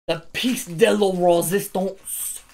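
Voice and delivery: slow, drawn-out mock French accent. To viewers, crowning the last pack in a French accent. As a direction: savor it in a hammy French accent. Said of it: "that French one comes out".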